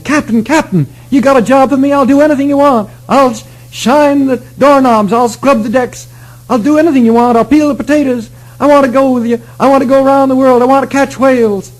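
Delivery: put-on high, eager pleading boy. Young boy begging the captain for a job, eager and high. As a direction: beg the captain like an excited kid.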